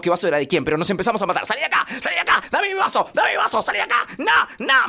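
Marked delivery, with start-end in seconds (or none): 1.5-4.9 put-on high, loud shrieking kids fighting over a glass; kids screaming fight. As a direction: scream like a kid defending his juice. Hermanos pelean a gritos. (gritos agudos de chicos peleando)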